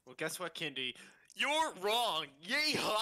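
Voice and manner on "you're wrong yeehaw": high, loud playful shout; teasing friend shouting back a yeehaw; mock yell. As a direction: yell it like a rowdy cowboy. Teasing friend, shouting cowboy cry.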